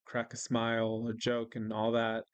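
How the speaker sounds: normal talking voice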